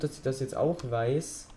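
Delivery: ordinary talk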